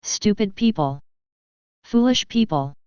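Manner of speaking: a touch of shouted anger; mild angry shout. Acted. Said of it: "went on shouting".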